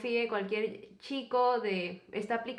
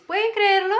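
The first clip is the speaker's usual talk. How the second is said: much higher and louder, hooking the viewers; excited disbelief; 9 semitones higher, 9 dB louder than usual. Real, not acted. To her YouTube viewers, amazed and much louder. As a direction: sell the shock to your audience.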